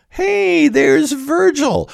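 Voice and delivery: a lecturer gleefully pointing out Virgil standing there; mock surprise. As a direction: sing it out like spotting a friend in a crowd. Playfully spotting Virgil in the text.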